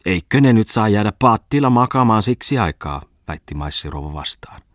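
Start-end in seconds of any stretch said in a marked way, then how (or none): 0.0-3.0 the lady's retort. A narrator's reading. The lady argues back.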